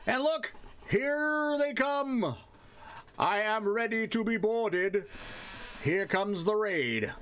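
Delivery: high-pitched, drawn-out lookout's cry; hammed-up calling out. Streamer announcing an incoming raid, hammed-up calling out. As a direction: stretch the vowels high like a mock lookout.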